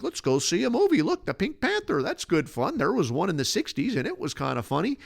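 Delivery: high, excited parents pitching a movie; mock parent voice. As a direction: play the clueless folks, bright and eager. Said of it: "they're like".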